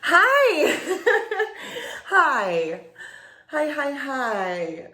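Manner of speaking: high, loud hi with laughter; excited greeting. Acted. Old friend appears on the video call, excited greeting. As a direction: squeal hello, giggling, then draw it out.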